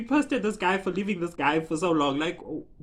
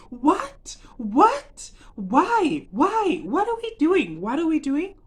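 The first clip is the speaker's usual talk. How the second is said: high, punchy repeated questions; exasperated disbelief; 8 semitones higher, 5 dB louder, much slower than usual. Acted. Fan demanding why they act like this, incredulous. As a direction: fire off baffled questions in mock outrage. Mock outrage over the plot.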